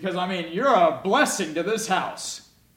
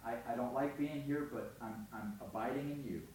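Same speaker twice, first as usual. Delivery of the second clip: much softer, hushed prayer; quiet prayer; 2 semitones lower, 14 dB quieter than usual. Acted. Joseph praying softly in prison, hushed. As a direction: murmur it like a private prayer in a cell. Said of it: "and he says".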